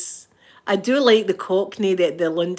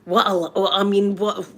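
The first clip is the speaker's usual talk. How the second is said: ordinary talk, nothing marked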